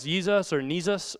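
first "Jesus" starts with Y, second "Jesus" with N; child's mispronunciations compared. Shown as a demonstration. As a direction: say "Jesus" as "Yeesus", then as "Neesus". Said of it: "can't tell if it's".

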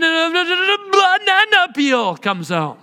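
banana peel said high and slow; high, slow, rising. Shown as a demonstration. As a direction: pitch it up high as the rage spills over. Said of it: "It gets up here all of a sudden".

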